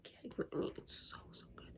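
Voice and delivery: whispered adoration; soft whisper gushing over the book. Real, not acted. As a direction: whisper it like a secret love. Gushing to herself.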